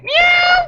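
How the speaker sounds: very high, loud fake meow; cat meow. Redhead hiding from cops in a basket, squealed cat meow. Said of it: "and the redhead went".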